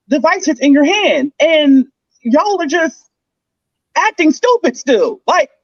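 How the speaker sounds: high-pitched, incredulous venting; real frustrated bafflement; exasperated rant. Real, not acted. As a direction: rant in disbelief, pitch lifted throughout. Exasperated rant about others' behavior.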